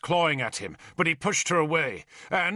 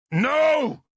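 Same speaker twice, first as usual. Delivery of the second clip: loud, high shout; 13 semitones higher, 6 dB louder than usual. A narrator's reading. Derval trying to stop the killing, shouted. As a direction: shout it out in protest. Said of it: "I shouted".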